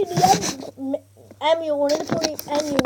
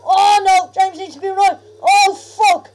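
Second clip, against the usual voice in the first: panicked yelling; 4 semitones higher, 8 dB louder than usual. Real, not acted. Zombies attacking teammate.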